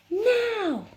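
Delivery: very high, loud drawn cry; urgent shout. Acted. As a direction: shout it with all your force. Heroine shouts while pushing.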